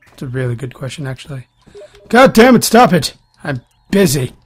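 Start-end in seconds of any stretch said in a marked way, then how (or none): 2.1-4.3 loud, higher exasperated shout; angry yell. Real, not acted. Gamer yelling at the interruption, angry shout. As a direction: bark at the interruption.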